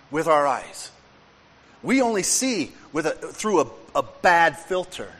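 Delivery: fervent sermon delivery; preaching. Acted. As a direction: drive the message home from the pulpit. Preaches with full force.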